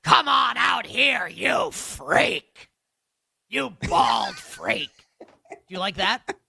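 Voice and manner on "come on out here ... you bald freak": slow, stretched shout, higher pitch, breaking into a laugh; Vince McMahon impression. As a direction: bellow it out like a wrestling promoter. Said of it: "Maybe I'll just do it".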